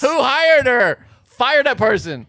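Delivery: high, slow, loud demand; mock outraged shout. Co-host piling on the ad's writer, mock outrage. Joins in ridiculing the video.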